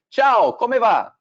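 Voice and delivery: put-on slow sing-song Italian accent; Italian greeting. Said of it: "greet you in Italian".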